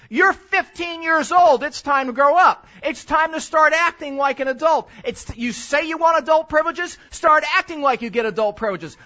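loud, scolding parent; stern lecture. Retold or imitated. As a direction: lay down the law to your teen, loud and firm. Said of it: "And here it is".

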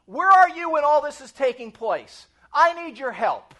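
loud, insistent plea to God; guts praying. Acted. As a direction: demand an answer boldly, then plead for help. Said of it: "There's guts praying".

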